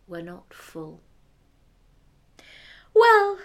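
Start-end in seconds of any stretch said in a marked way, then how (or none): 2.9-3.4 high, loud haughty princess; cartoon princess. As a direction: proclaim it like a spoiled princess. Eldest girl voiced again.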